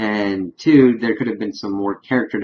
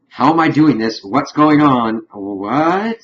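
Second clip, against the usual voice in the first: mock panic; 6 semitones higher, 7 dB louder, slower than usual. Imagining the panicking woman's reaction.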